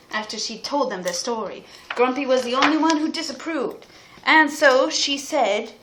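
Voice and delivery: ordinary delivery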